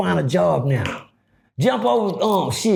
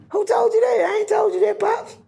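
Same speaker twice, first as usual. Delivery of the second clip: high startled reply. Retold or imitated. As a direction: voice jumps up high in mock alarm. Acted prison quarrel, high voice.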